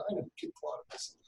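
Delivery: a hushed, breathy aside; mock whisper. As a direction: breathe it like a secret. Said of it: "mimicking a stranger".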